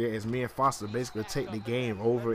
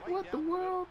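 high, stunned squeak of disbelief; shocked at a dropped touchdown; 3 dB quieter, slower than usual. Real, not acted. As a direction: react like you can't believe what you just saw.

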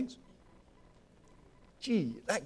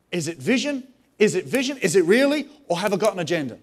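questions growing louder; louder, rising challenge; building preacher's questions; 4 semitones higher, 7 dB louder than usual. Real, not acted. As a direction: push harder with each repeat. Escalating repeated questions.

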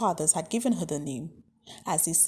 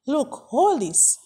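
insistent; 5 semitones higher, 6 dB louder, slower than usual. A narrator's reading. Father presses money on friend.

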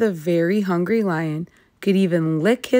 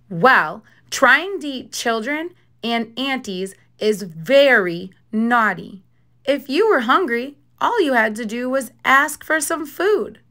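Little Red scolding; 6 semitones higher than usual. A narrator's reading. Little Red lecturing lion.

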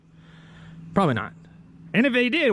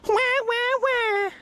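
high mock baby wail; mock crying; much slower than usual. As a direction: wail wah-wah like a crybaby. Mocking a crybaby.

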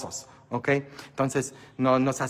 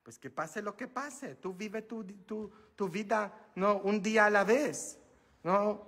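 soft counsel; 6 semitones higher, 8 dB quieter than usual. Real, not acted. Calm counsel after outburst.

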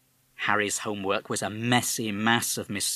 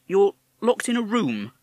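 policeman; 11 semitones higher, 7 dB louder than usual. Acted. Policeman probes.

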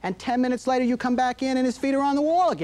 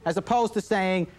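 usual speaking voice

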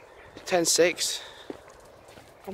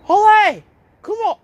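loud high call to a stuck dog; 14 semitones higher, 9 dB louder than usual. Real, not acted. Calling her dog from across the field, loud and high. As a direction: shout the dog's name across the field.

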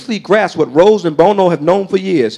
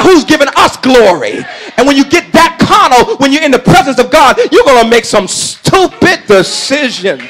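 raised, emphatic pulpit preaching; 9 semitones higher, 5 dB louder than usual. Real, not acted. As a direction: drive each point home to the congregation. Preaches at full voice.

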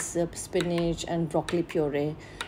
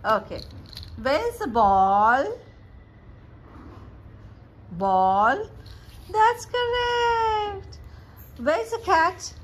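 drawn-out, high-pitched encouragement; 11 semitones higher, slower than usual. Real, not acted. Mother quizzing her baby on pictures, playful baby talk.

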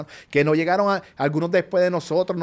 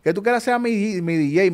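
quoting Vico; 6 semitones higher than usual. Retold or imitated. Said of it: "Vico me dice".

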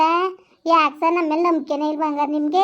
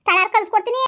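pitched up, chatty village woman; high village woman voice; 4 semitones higher than usual. Acted. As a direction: lift the voice into a sing-song rustic woman. Village women chat, raised voice. (ಎತ್ತರದ ಧ್ವನಿಯ ಹಳ್ಳಿ ಹೆಂಗಸು)

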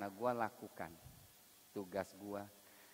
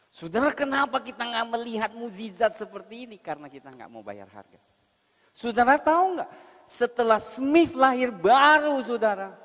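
preaching at full force; 13 semitones higher, 15 dB louder than usual. Acted. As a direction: hammer the point home from the pulpit.

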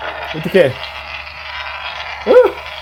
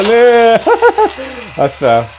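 usual speaking voice

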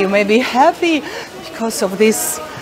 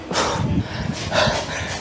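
gasping exhale; 8 dB quieter than usual. Real, not acted. Burning mouth from hot pepper.

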